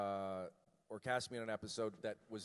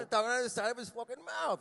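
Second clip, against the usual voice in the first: higher, hammed-up American accent; side-of-mouth American accent; 11 semitones higher, 3 dB louder, faster than usual. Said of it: "Jackson was talking like this".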